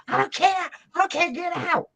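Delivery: high, insistent mock screech; screaming woman. Woman dismissing the bear's hard day, screaming. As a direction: cut them off in a high acted scream. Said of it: "this woman comes running up screaming".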